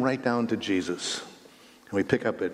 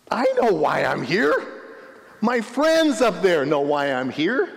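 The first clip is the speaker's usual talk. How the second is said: paralytic's voice; 6 semitones higher, 4 dB louder than usual. Pretend. Paralytic continues imagined reply.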